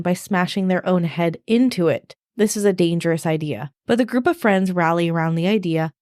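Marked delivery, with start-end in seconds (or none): none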